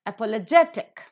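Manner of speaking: "Apologetic" stressed on GET; stress before the suffix. Shown as a demonstration. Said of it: "words that are ending with ik".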